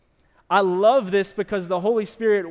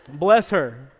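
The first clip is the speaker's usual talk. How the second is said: laughing; 3 semitones lower, 7 dB louder than usual. Real, not acted. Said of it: "But bless her, for sure".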